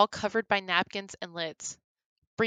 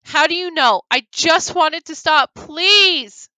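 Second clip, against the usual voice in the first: loud, higher tearful begging; crying plea; 9 semitones higher, 10 dB louder than usual. Acted. Bree crying out to Grum, begging it to stop. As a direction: plead through tears. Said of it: "she cried".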